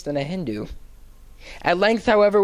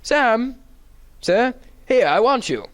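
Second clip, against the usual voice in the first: high, louder call across the ice; 6 semitones higher, 3 dB louder, slower than usual. A narrator's reading. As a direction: shout to Sam from the far bank. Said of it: "shouted from the opposite bank".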